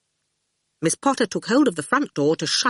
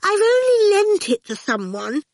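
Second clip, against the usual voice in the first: high-pitched affronted protest; indignant; 3 dB louder than usual. A narrator's reading. Miss Potter defending herself to the children, indignant. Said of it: "exclaimed indignantly".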